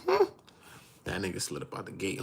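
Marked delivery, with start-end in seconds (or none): 0.1-0.2 high-pitched puzzled hmm; a sceptical hum, way up high. Retold or imitated. Reacting to the big dude sliding in, high hmm. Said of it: "I was like".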